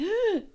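high imitated gasp; shocked gasp. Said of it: "he's just like".

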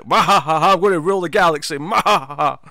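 hammed-up evil villain laugh and gloat; mustache twirling villain. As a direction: cackle like a cartoon villain. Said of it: "maniacal villain with the, you know, the mustache twirling".

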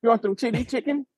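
lightly put-on Chinese accent; mock Chinese accent. Podcaster goading a friend with food jokes, mock Chinese accent. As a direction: joke line in a rough Chinese accent. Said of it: "Give us a Chinese accent".

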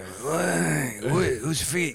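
high, puzzled put-on voice; mock confused voice. Comic in disguise mocking someone, high and puzzled. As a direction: play dumb in a high silly voice. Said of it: "I just go".

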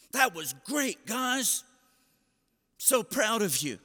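high, upbeat praise after a loss; encouraging coach. Retold or imitated. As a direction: imitate a relentlessly positive coach. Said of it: "he was an incredible encourager".

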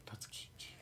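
a soft aside, barely breathed; whispered realization. Retold or imitated. Spotting Kiki in clown paint on a photo, a whispered double take. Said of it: "And I was like".

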